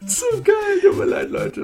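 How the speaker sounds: high, breathless crying with laughter; helpless laughter. Real, not acted. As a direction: laugh until the tears come. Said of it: "Ich weine".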